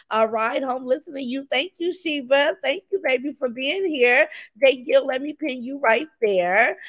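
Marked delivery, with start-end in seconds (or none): none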